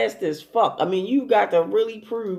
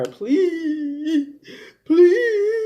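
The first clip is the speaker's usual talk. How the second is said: high-pitched, drawn-out mock begging wail; 10 semitones higher, 4 dB louder than usual. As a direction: imitate a whining grown man pleading. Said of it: "on the phone begging her".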